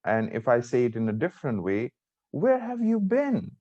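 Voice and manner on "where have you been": high, drawn-out worried question. Shown as a demonstration. Teacher showing students another tone, worried. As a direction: ask slowly in high-pitched worry. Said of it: "it means I'm worried".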